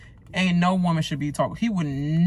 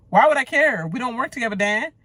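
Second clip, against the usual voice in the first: sharp retort; 7 semitones higher, 5 dB louder, faster than usual. Pretend. Imagined retort to Dan.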